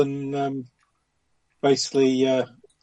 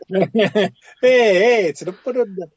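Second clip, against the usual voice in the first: Eddie Waring commentator; 4 semitones higher, 6 dB louder than usual. Retold or imitated. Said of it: "It was wearing".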